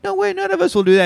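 high, fast, squeaky denials; high protesting disciples. Retold or imitated. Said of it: "And they're like".

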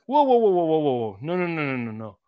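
rapid-fire whoas, loud start settling down; retold teacher's interruption. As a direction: halt a mouthy student in mid-sentence. Retold cutting off a student's attitude.

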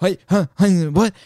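high, stuttering confusion; mock flabbergasted. Manager stunned at losing all the power, mock sputtering. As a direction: imitate a boss who has suddenly lost control. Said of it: "the power just disappeared".

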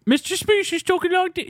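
high-pitched British character voice; Mister Smee. Acted. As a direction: squeal it in a hammed-up British accent. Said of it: "he has a British accent in that".

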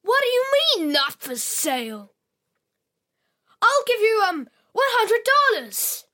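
put-on high, loud bratty girl; spoiled girl voice. Girl demands doll.

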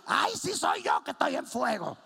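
loud laughing yelp of pain; mock panic. Preacher after burning his leg on the pipe, laughing yelp. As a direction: cry out in comic alarm.

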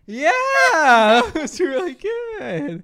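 high, drawn-out fake enthusiasm; laughing, strained mock approval; pretend approval. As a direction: stretch out loud, unconvincing praise. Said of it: "You should have started pretending".

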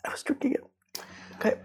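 strained, breathy voice between coughs; coughing on dust. Real, not acted. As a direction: voice half gone from coughing. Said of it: "I inhaled some dust".

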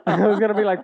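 laughing through the words; chuckling as he talks. Real, not acted. Laughing while telling.

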